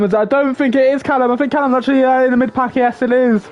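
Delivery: quick, lively race commentary; live play-by-play. Acted. Calling the race start for viewers, lively play-by-play. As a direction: call the race as it unfolds.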